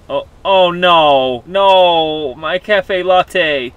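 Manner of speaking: loud, slow mock despair; dismayed wail. Real, not acted. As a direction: wail over the spilled latte. Cup knocked over.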